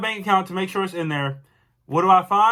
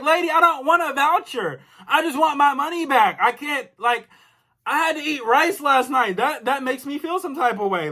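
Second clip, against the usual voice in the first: higher-pitched, insistent retold complaint; indignant refusal; 5 semitones higher than usual. Customer refusing the lady's voucher offer, indignant. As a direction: turn the offer down, fed up.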